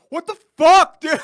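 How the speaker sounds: high, very loud imitation of an angry brother; mock furious outburst. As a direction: explode in exaggerated outrage, retelling it. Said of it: "he was so mad".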